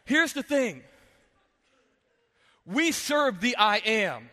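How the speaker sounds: slow, emphatic preaching. Acted. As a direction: build it like a pastor's altar call. Preaching climax to congregation.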